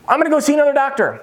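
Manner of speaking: high, fast mock alarm; panicked patient, mock. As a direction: blurt it out like a patient bolting. Acts patient fleeing the alarmed doctor.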